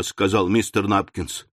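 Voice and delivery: ordinary delivery, nothing marked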